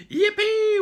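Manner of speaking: very high, loud yippee; joyful cheer. Acted. Jungle creatures celebrating, squealing yippee. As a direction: squeal it with delight.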